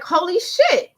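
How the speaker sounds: high, loud exclamation; retold astonished outburst; amazed realisation. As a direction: blurt it out, stunned by the realisation. Retold moment of sudden realisation.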